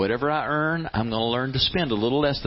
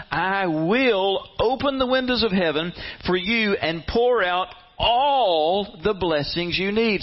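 solemn promise; 7 semitones higher, slower than usual. Acted. Voicing God's promise.

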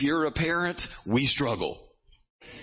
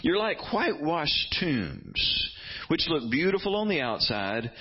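high, slow prophetic rebuke; preaching at full force; 5 semitones higher, slower than usual. Retold or imitated. Preacher voicing Jesus's woes to Pharisees, slow high rebuke. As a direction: condemn them word by word. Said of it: "he goes on to say in verses".

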